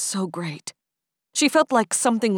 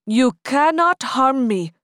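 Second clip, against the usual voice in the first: loud, slow, unshaken declaration; calm defiance; 7 semitones higher, 7 dB louder than usual. Acted. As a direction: calmly defy the attacker. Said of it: "Hatim said".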